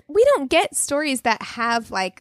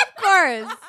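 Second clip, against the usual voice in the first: laughing; 3 semitones higher than usual. Real, not acted. Laughing at the story.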